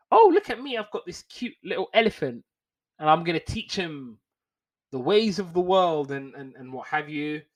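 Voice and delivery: high, showy mock socialite voice; hammed-up mock socialite boasting; mocking posh lady. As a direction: preen and show off the elephant. Mocking Madame showing off Babar.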